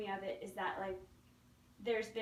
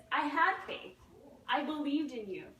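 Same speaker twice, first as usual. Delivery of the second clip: ticked off; 4 semitones higher, 6 dB louder than usual. Acted. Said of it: "he's a little ticked off".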